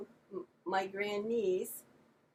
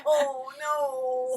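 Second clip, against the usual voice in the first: louder, higher mock dismay; the penny drops, playful horror; 5 semitones higher, 8 dB louder than usual. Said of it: "I went".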